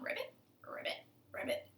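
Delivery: soft, toneless pig ribbit; quiet pig playing frog. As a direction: croak little ribbits under your breath. Pig ribbits all day.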